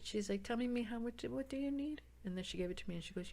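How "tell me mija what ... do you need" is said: soft, higher, sweet voice; imitating a kindly, soft-spoken supervisor. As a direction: gently offer help to a customer. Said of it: "who was so sweet".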